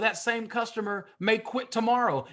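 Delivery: ordinary talk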